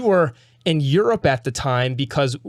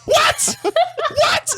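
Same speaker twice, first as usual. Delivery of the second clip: squeaky, much higher repeated what, breaking into a laugh; shocked what; much slower than usual. Retold or imitated. Friend mimics him yelping at the show from the bunk, in high mock disbelief. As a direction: mimic yourself gasping at the screen, higher each time. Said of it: "Justin below me says, going".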